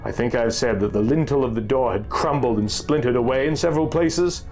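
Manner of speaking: ordinary delivery, nothing marked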